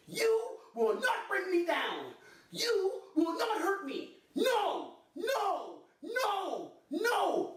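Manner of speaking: high, drawn-out defiant cries; hammed-up high-pitched defiance; self-defense cry. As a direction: draw out each word high like a self-defense drill. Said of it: "I learned that in my self-defense class".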